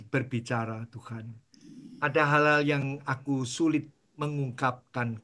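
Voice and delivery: low, soft prayerful voice; closing prayer. Acted. Pastor leading the congregation in closing prayer, soft. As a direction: pray it quietly and humbly.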